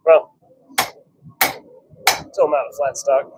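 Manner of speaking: usual speaking voice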